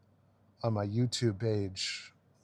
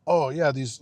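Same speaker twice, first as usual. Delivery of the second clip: mock European accent; 5 dB louder than usual. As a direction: start of a mocked European accent. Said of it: "try to talk like these fucking guys".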